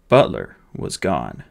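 usual speaking voice

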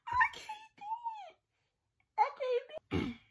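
high loud laugh-shouting; hysterical laughing. Real, not acted. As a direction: lose it completely laughing. Cracks up during the prank.

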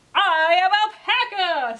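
high, loud, slow announcement as an alpaca; Alpaca character voice. A narrator's reading. Alpaca answers Llama after the unzooping, loud character voice. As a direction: declare it big and drawn out, in character. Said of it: "said alpaca".

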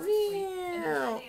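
high, light cat meow; playful meow. Pretend. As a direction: meow like a kitten for fun. Mom meows playfully in game.

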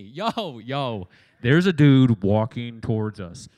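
loud, slow, alarmed call from the boat; freaked-out disciple. Retold or imitated. Disciple in the boat yelling to the others, loud and alarmed. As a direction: shout it like you can't believe your eyes.

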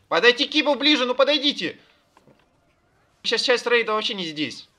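loud, high raid commands; shouted orders. Real, not acted.

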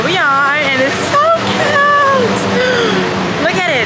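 high, slow, squealing delight; excited squeal. Real, not acted. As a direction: squeal like a thrilled fan. Squealing at the cute Hello Kitty store.

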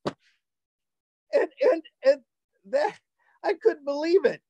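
high, halting, awestruck finish; incredulous amazement. Real, not acted. Storyteller who couldn't believe his host's identity, amazed. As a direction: stumble over the words in high-pitched disbelief.

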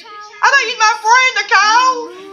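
high, hammed-up whimper; mock heartbroken. Whining to Nicole that she betrayed him, mock sobbing. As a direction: play the jilted friend, half crying.

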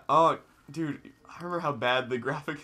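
normal talking voice

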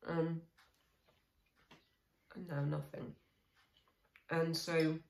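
speaking between bites of food; talking while chewing. Real, not acted. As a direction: mouth partly full of yogurt. Seen 0: took spoonful at -1.0, spoon and bowl in hand throughout.

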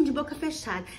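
normal talking voice

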